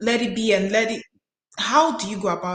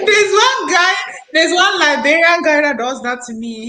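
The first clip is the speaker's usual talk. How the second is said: loud, higher, laughing through the words; 8 semitones higher, 8 dB louder than usual. Real, not acted. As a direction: burst out laughing as you tell it. Laughing while telling anecdote.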